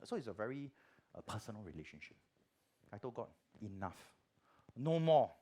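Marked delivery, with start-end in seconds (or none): 3.6-5.3 angry outburst. Retold or imitated. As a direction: snap it off, fed up with God. Quarrel with God retold.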